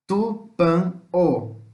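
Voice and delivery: TU, PAN, O with long gaps between; teacher's drawn-out breakdown of Tupan-o; syllable by syllable. Shown as a demonstration. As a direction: sound out Tupan-o very slowly in three separate beats. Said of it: "Três sílabas".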